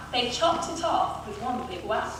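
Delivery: ordinary delivery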